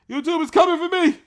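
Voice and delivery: YouTube yelled high and loud in mock alarm; hammed-up alarmed cry; mock panicked yell. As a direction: shout it like they're really coming for you.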